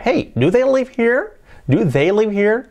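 high-pitched "Hey", then "here" lifted as a question; question intonation. Shown as a demonstration. Teacher shows the finished question, rising tune on "here". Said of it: "Así sería".

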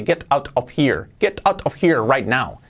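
slow "Get out of here", every word detached; over-enunciate "Get out of here", full t's; unnatural textbook English. Shown as a demonstration. Teacher shows how nobody talks, detached "Get out of here". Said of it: "Pero nadie habla así".

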